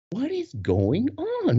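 high, slow, stretched disbelief; acted bewilderment. Dancer facing a screaming crowd, high slow bewilderment. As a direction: draw out every word, baffled.

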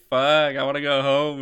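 mock whiny teenager; whining kid. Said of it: "fucking Tuke's like".

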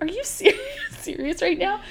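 high, laughing disbelief; retold exasperation, breaking into a laugh; exasperated disbelief. Stuck near the tunnel, she laughs in high disbelief. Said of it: "And I was like".

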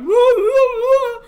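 high, loud, slow mock alarm wails; siren-like woo. Game master imitating panicking infrared clones, high and loud. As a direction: wail like panicked little sirens. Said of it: "The infrareds are just like".

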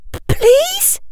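tiny, very high stammered plea; faltering plea. A narrator's reading. Hermione begs the bullies, faltering. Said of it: "in a faltering voice".

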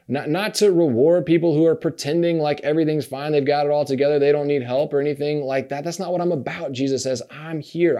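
ordinary talk

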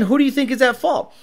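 normal talking voice

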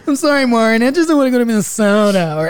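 high and loud, laughing through a mock apology; a laughing, hammed-up sorry. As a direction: squeal it out between laughs. Mocking a meme, laughing.

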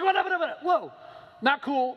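hammed-up startled squeal; flustered shock. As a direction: sputter in disbelief as the curse fails. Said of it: "And he's like".

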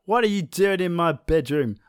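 hammed-up angry roar, pitched high; a cranky god caught in his bedroom; angry sun. The sun angrily confronting Maui in his bedroom, hammed-up and high. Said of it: "I'm really, really angry about this".

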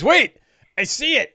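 high, loud exclamation; sudden discovery. Acted. Character pointing at a footprint, high excited voice. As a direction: interrupt yourself on spotting it.

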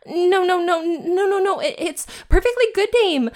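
high, rapid nervous protests; flustered high denial. Acted. Elaine frantically reassuring the demon about his name. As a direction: backpedal in a panicky squeak.

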